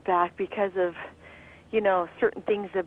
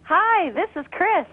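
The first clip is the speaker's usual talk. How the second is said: announcer voice; 6 semitones higher than usual. Shown as a demonstration. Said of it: "Do the voice, Chris".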